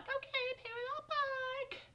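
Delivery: squeaky high mock examiner. Teen mocking her driving examiner, high squeaky voice. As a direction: mimic the examiner in a tiny high voice. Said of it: "she was like".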